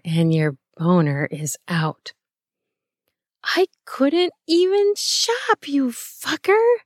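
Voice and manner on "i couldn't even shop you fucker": high, drawn-out mock outrage; fake pissed. A narrator's reading. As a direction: complain in a high voice, only pretending anger. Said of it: "sort of fake pissed".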